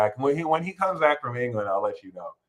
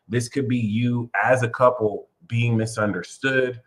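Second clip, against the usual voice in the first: ordinary delivery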